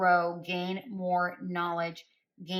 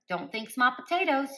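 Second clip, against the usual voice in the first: quoting spirit; 6 semitones higher, 6 dB louder than usual. Retold or imitated. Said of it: "That's how they said it too".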